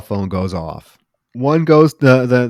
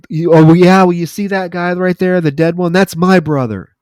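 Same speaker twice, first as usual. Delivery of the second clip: loud, raised-pitch mock comeback; retold angry retort; 7 semitones higher, 7 dB louder than usual. Killer answering Felix about his own dead brother, acted indignant retort. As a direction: throw it back at the boss, imitating a henchman.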